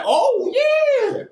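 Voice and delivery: high, pleased "Oh, yeah"; a high, happy groove; delighted oh yeah. Retold or imitated. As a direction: sing out your delight at the music. Enjoying the music, high voice.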